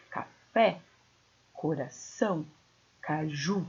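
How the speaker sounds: "Café, coração, caju" leaning on each final syllable; stress on last syllable. Shown as a demonstration. Teacher gives oxytone examples, final-syllable stress. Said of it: "é a última da palavra".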